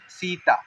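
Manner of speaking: सीता with the "ee" held; held long i. Shown as a demonstration. Teacher demonstrating the long vowel sign, held long. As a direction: linger on the first vowel of सीता. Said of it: "वहाँ पे देर तक रुकना है".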